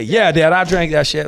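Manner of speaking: high, loud mock confession; owning up. Kid admitting to dad about the milk, loud ready confession. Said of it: "just own it and say".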